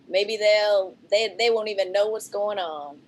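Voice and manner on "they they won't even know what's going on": ordinary talk, nothing marked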